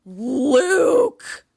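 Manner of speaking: loud, drawn-out outraged yell of the name; scolding cry. Acted. Reacting to Luke's scare excuse, outraged scolding. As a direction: wail it at him in reproach.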